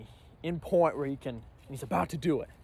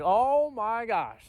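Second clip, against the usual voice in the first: high, drawn-out disbelief; retold shock; 6 semitones higher, 4 dB louder, much slower than usual. Lookout seeing an ATV coming, shocked. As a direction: stretch it out slowly, pitched up.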